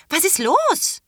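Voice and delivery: high, loud alarmed cry; horrified question. A narrator's reading. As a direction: ask in sudden panic. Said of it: "fragte Terra entsetzt".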